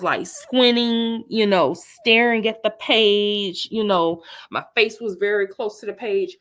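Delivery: ordinary talk, nothing marked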